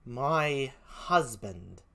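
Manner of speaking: put-on very slow, stunned British accent; Emilia. Gentlewoman echoes the news, slowly in a British accent. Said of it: "please forgive my poor British accent".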